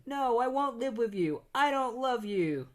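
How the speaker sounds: defiant refusal. Retold or imitated. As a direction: spit out the rejection. Boy refuses father.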